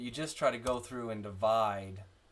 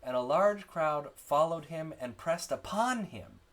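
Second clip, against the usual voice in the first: slow, raised reading with "upon" stressed and "him" falling; 5 semitones higher, 4 dB louder, slower than usual. Shown as a demonstration. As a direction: read it slowly, leaning on "upon". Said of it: "watching out for prepositional phrases".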